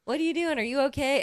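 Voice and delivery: high, frantic mock cleaning lady; housekeeping ladies shouting. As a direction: squeal it like a panicked housekeeper. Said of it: "housekeeping ladies are like".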